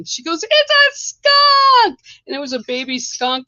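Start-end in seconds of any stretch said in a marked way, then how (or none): 0.4-2.0 high, loud, slow drawn-out shriek; retold terrified scream. Daughter wailing to her mother about the skunk, in a slow high shriek. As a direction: stretch it out in a wailing panic. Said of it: "screaming, yelling".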